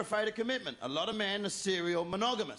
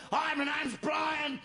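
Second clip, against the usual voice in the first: hunchback voice; 5 semitones higher, slower than usual. Retold or imitated. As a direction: drag it out like a one-eyed oddball. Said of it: "someone with a hump and one eye going".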